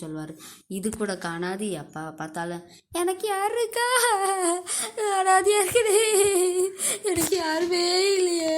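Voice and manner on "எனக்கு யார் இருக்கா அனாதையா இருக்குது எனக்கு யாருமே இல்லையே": much higher and much louder, whiny crying; exaggerated crying lament of an orphan; mock wailing. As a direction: ham up the old man's self-pitying sob. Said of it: "அப்படின்னு சொல்லிட்டு அழுதுட்டு".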